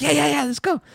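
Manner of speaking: high eager rush of yeahs; a kid thrilled to go; excited kid. Retold or imitated. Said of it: "She's like".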